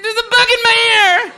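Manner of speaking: put-on high, loud, drawn-out kid wail; little skinny kid's voice. Little kid reporting a bug to campers, shrieking imitation. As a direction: imitate a panicking child screaming, very high. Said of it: "his voice was like that".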